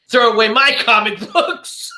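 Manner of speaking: loud, high imitation of an angry boy; hammed-up revenge line, breaking into a laugh; mock vengeful kid. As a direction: play the furious kid for laughs. Retold boy's revenge while stabbing doll.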